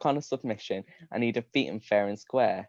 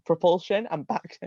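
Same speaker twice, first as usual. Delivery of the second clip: usual speaking voice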